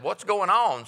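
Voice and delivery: a farmer gaping at the sky; astonished farmer. Acted.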